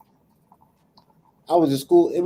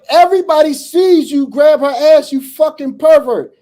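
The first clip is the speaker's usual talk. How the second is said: put-on high, loud furious yelling at the kid; retold furious yell at a pervert student; angry yell; 13 semitones higher, 7 dB louder than usual. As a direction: chew him out at full volume. Yelling at the boy in school.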